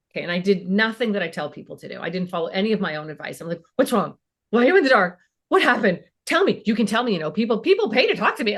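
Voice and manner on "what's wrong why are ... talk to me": louder, rapid-fire worried questions; frantic mom. Retold or imitated. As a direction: smother him with panic. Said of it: "Like, I just lost it".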